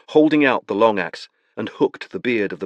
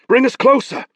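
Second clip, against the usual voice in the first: urgent command; 6 dB louder than usual. Acted. Beron urging rowers on.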